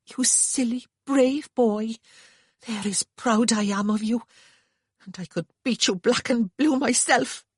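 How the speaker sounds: high, emotional Welsh lilt; proud and near tears; tearful Welsh sister. A narrator's reading. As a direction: scold him through rising tears. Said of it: "She burst into tears".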